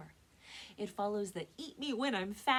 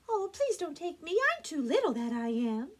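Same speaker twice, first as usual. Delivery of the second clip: high, raised little-goat voice; small pleading goat; 11 semitones higher, 9 dB louder than usual. Acted. Smallest goat begs the troll, high pleading voice. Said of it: "said the billy goat".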